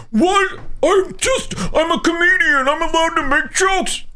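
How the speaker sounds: very high, whiny mock defensive voice; whiny fraud. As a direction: play the squealing backpedaler. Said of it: "and then they go".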